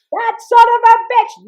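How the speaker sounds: high, loud outburst of fury; imitated high-pitched angry yell; mother blowing up. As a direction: explode like an enraged mother. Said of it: "my mother blew".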